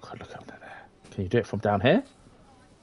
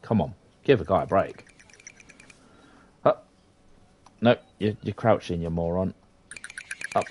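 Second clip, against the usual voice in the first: usual speaking voice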